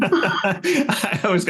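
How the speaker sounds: high, amused laughing start; real laughing. Real, not acted. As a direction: break into laughter as you start answering. Amused reply to teasing comeback.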